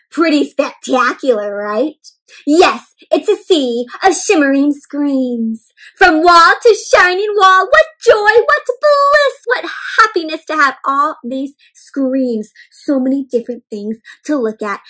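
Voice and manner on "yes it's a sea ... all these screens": gushing, slow at first and higher on what joy; a hammed-up couch potato boasting; smug couch potato. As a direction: revel in every screen like a lazy king. Couch Potato's lines in book.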